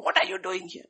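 loud, high-pitched shouted question; zealous shout. Shown as a demonstration. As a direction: shout it like an overzealous scolder. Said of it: "we in our zeal may shout".

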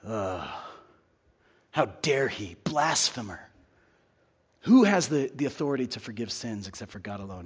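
a light, low-key indignant grumble; grumbling Pharisees. Acted. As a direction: mutter it offended under your breath. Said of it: "the Pharisees are grumbling".

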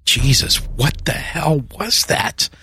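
rising disgust. A narrator's reading. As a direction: recoil from the stench. Disgusted at the smell.

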